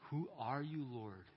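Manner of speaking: hushed awe with a touch of dread; awed reverence. Acted. Saul answers the voice from heaven, mildly awed and fearful. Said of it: "awed reverence, maybe with a mixture of dread and fear".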